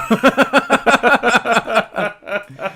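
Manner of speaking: big burst of laughter; real laughing fit; loud laugh. Real, not acted. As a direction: crack up loudly.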